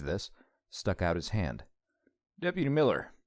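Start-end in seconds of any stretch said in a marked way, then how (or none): 2.4-3.1 put-on friendly small-town lawman with a heavy Southern accent. Deputy introducing himself to Lance, heavy Southern accent. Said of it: "and boy was that accent heavy".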